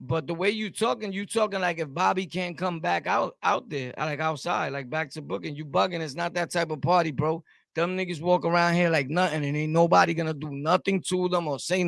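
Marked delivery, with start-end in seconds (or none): none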